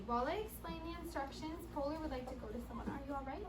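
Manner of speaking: soft worried teacher voice; hushed concern. Real, not acted. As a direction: quietly ask if she's okay. Checking on hurt child.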